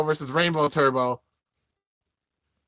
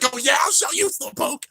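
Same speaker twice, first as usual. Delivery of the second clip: put-on indignant kid retort. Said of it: "he's like".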